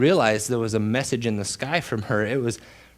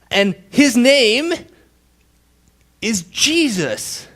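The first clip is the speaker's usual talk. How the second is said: rising, louder, slowing to a climax on Jesus; preaching at full force; 7 semitones higher, 5 dB louder than usual. Acted. Preacher to the congregation, building proclamation.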